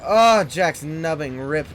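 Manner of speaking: ordinary talk, nothing marked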